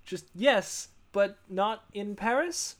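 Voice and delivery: mock code phrase. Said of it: "I'm just like".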